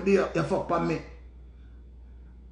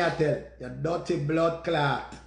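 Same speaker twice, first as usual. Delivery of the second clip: normal talking voice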